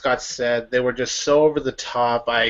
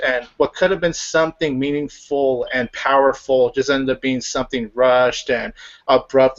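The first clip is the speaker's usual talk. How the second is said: normal talking voice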